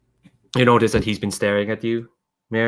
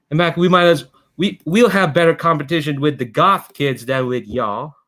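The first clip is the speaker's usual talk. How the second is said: high, loud, cocky schoolboy taunt; a cocky steampunk kid mocking the debate rival; 7 semitones higher, 4 dB louder than usual. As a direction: sneer down at her, pitched up. GM voices taunting steampunk boy.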